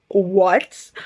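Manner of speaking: a loud, drawn-out rising what; stunned what. Real, not acted. Reader reacts to the book's ending with shocked what. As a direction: shout your disbelief.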